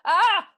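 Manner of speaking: high, loud mock shriek; imitated scream. Acting out her scream in the dark staff room, mock shriek. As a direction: let out a short startled "ah". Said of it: "I also screamed".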